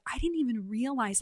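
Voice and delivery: usual speaking voice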